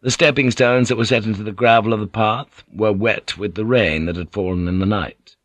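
ordinary delivery

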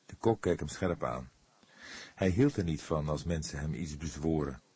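plain reading voice

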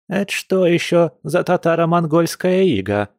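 high, held-up granny voice; indignant old woman. Acted. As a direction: demand an explanation as an outraged granny, pitched high. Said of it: "возмутилась старушка".

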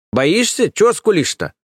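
high, taunting nanny voice; mocking a whimpering grown man; scolding nanny. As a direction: needle him like a bossy nanny. Nanny scolds cowering idiot.

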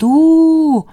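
long, high-pitched howl; wailing train hoot. A narrator's reading. Son howling through the wall, train hoot. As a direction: hold a high "oo" like a train whistle. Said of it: "а он вопит".

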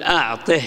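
أعطه ending in an audible h; ha of silence. Shown as a demonstration. Teacher applying the rule, أعطه ending in h. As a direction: close أعطه on a breathed h. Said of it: "وقف بها السكت".

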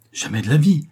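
high, loud cry of alarm; terrified cry. A narrator's reading. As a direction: shout it out in horror. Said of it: "s'écria-t-il, terrifié".

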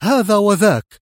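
loud, high, angry retort; exasperated. A narrator's reading. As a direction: throw the answer back in exasperation. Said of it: "أجاب في حنق".

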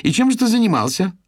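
high, loud menacing question; stern demand. A narrator's reading. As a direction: glare and demand an answer. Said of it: "Ирис грозно нахмурилась".

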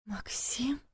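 barely audible whisper. A narrator's reading. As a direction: whisper his name, barely heard. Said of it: "едва слышно прошептала она".

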